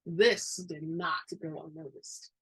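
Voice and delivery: ordinary delivery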